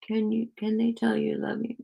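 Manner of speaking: high, cooing dog voice; pet talk. Real, not acted. Talking to the dog who loves her, cooing. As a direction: coo it high to a beloved pet.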